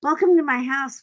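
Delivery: high, bright-eyed dog greeting a guest; high, loud friendly dog welcoming company; eager puppy voice. Retold or imitated. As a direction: gush hello like an excited little dog. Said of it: "They're like".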